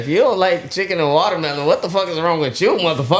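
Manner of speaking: Dave Chappelle bit. Retold or imitated. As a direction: hype up the punchline as the comedian. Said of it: "He said".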